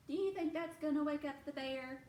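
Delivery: ordinary delivery, nothing marked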